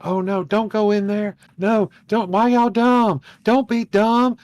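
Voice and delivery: high, loud scolding of the characters; hammed-up high-pitched heckling; talking at the screen. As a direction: warn the movie characters. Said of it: "I kept hearing, like".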